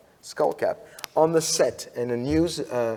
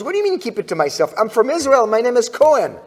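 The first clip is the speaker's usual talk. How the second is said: louder, higher retort, half acted; indignant comeback; 8 semitones higher, 6 dB louder, faster than usual. Guest answering the TV host, raised indignant retort. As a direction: fire back the proud reply louder. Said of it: "And he goes".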